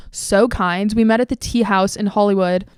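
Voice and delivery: usual speaking voice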